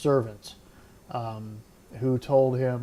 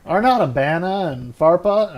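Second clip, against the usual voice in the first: indignant; 4 semitones higher, 8 dB louder than usual. Acted. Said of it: "Naaman went away angry".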